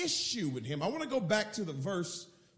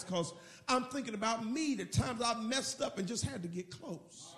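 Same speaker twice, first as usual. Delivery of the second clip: ordinary talk, nothing marked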